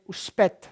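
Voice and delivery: uspet with s said as sh, ush-PET; Neapolitan sh sound. Shown as a demonstration. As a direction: say uspet Neapolitan style, hushing the s. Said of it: "often have the sh sounds".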